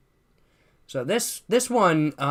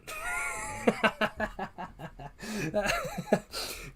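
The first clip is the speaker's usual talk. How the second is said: high, long burst of laughter; 10 semitones higher, 5 dB quieter than usual. Real, not acted. Streamer amused by his own far-fetched claim, laughing hard. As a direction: crack up at your own joke.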